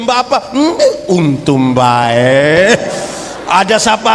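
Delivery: drawn-out coy mock wife; lightly voiced teasing wife, slowed. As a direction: stretch it out teasingly. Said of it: "dia bilang".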